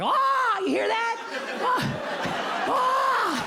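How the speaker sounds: shrieking in mock fright; panicked wife. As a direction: squeal like a scared wife hearing a rodent. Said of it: "But my wife is like".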